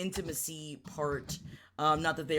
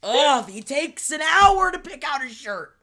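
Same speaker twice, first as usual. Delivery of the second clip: exasperated; 9 semitones higher, 11 dB louder than usual. Real, not acted. Complaining about Edward.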